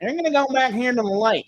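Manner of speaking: a hint of a hillbilly clerk; hillbilly drawl. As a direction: lightly play the backwoods shop guy giving directions. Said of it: "hillbilly as hillbilly gets".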